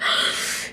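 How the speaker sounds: a sudden unvoiced gasp of shock; sharp gasp. Real, not acted. Fan reacting to the crossover news, sharp gasp. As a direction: catch your breath in surprise.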